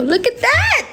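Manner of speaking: high, sudden exclaim; surprised cry. Real, not acted. Reacting to the timer hitting its end. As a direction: squeal at the clock.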